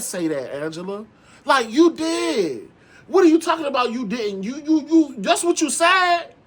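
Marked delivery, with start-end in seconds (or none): 1.4-6.3 high, louder, slowed insistence; rising incredulous call-out; exasperated callout. Real, not acted. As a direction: hammer the accusation home, incredulous. Calling out Angela's lie.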